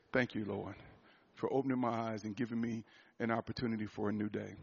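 hushed, soft prayer of thanks; soft morning prayer. Retold or imitated. A man voicing his quiet waking prayer. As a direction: murmur thanks to God on waking.

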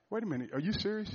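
normal talking voice

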